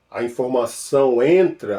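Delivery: normal talking voice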